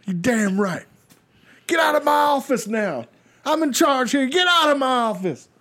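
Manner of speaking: high-pitched blustering boss voice; puffed-up screechy big shot; pompous shrill tyrant. Pretend. Boastful boss character bit.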